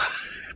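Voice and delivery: quick breathy chuckle; short laugh. Real, not acted. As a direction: laugh breaking the reading. Laughs mid-line reading.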